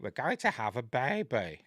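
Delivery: put-on sitcom character voice; Vivian from The Young Ones. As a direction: quote it as a comic impression, voice unlike your own. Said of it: "quote Vivian from The Young Ones".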